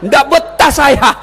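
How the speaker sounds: loud, drawn-out comic complaint; fed-up jinn. Acted. Jinn complaining about the nagging wife below, fed up. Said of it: "Saya iblis jin penunggu sumur ini".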